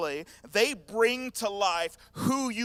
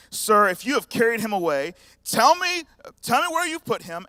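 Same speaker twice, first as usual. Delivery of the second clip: pleading cry; 4 semitones higher, 9 dB louder than usual. Acted. Mary pleading with the gardener.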